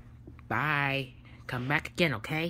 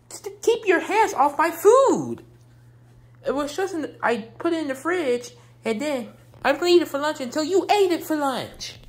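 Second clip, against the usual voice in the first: high and loud, the first line slowed; wife scolding husband over leftovers; angry scolding; 7 semitones higher, 4 dB louder than usual. Acted. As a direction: scold him for eating her food. Wife scolds Davon about spaghetti.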